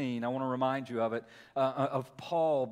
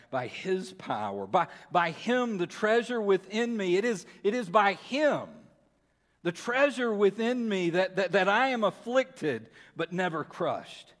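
building, louder proclamation; full-force preaching; 4 semitones higher, 3 dB louder than usual. Acted. Preacher proclaiming God's strength to congregation, full force. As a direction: preach it with rising power.

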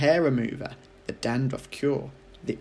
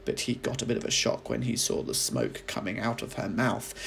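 ordinary delivery

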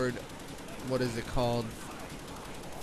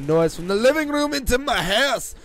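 raised, higher voice building on the complaint; scolding; 9 semitones higher, 7 dB louder than usual. Real, not acted. Scolding brother for letting noise in, raised voice. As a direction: complain loudly, pitch climbing.

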